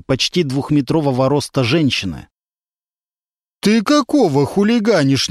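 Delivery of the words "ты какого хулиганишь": gruff, cross rebuke, pitched up; a burly woman driver snapping at a man; scolding in a low voice. A narrator's reading. Driver scolds a man blocking her door, gruffly. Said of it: "низким голосом стала ругаться женщина-водитель".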